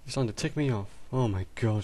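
usual speaking voice

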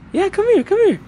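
very high, sing-song coaxing; high pet call. Real, not acted. Vlogger coaxing the dog to come, very high pet call.

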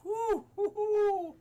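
breathy exhale into high hoots; real post-scare whooping exhale; repeated high whews. Real, not acted. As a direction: hoot the nerves out. Calming down after the scare.

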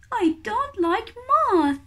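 high little chick voice, pouting; fussy chick. Acted. Baby kiwi refusing weta, high and pouty. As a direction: pout it out like a picky baby bird. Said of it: "says Hooey".

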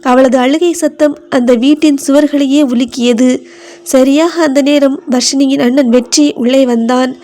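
plain reading voice

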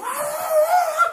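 very high laughing shout; drunks' yell imitated. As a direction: let out a wild howl mid-laugh. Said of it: "Tenían una gritera adentro".

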